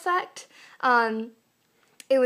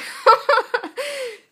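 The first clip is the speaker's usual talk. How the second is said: high bursting laugh that trails down; amused laugh at her own moodiness; real laugh; 12 semitones higher, 6 dB louder than usual. Real, not acted. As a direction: break into a genuine laugh at yourself.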